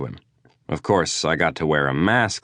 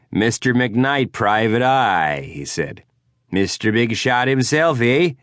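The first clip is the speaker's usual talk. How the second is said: slow, higher Yooper accent, mocking; Yooper twang; 6 semitones higher, slower than usual. Drunk Prudell taunting McKnight in a Yooper accent. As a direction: sneer it with a Yooper rise on eh. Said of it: "that distinctive Yooper twang".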